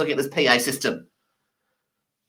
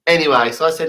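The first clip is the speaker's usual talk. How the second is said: ordinary talk, nothing marked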